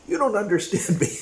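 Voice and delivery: high mock whiny complaint. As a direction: parody a hurt partner's reproach. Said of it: "they complain when".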